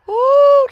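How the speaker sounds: sharp, very high, loud whoop. Real, not acted. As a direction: let out a big shocked whoop. Reacting to her family remark.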